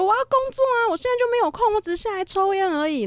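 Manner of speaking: imitating an angry outburst, high and loud; explosive roar. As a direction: yell excuses in a high strained voice. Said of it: "然后他就继续爆吼说".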